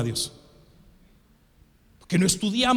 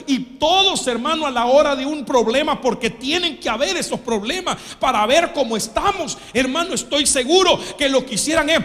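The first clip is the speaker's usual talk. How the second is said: loud, higher preaching shout; preaching loudly; 5 semitones higher, 6 dB louder than usual. Acted. Preaching at full force. (predicación a gritos)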